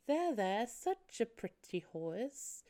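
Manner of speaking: creepily soothing, drawn out and hushed at the end; Salad Fingers. Retold or imitated. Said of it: "She almost sounds like Salad Fingers".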